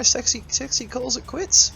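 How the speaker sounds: high, rising announcement of the quit; excited call. Real, not acted. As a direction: call it out in disbelief. Player surrenders, caster exclaims.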